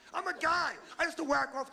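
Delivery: high, exasperated protest; exasperated retort. Acted. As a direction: throw it out high, as if obvious.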